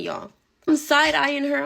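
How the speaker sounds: ordinary delivery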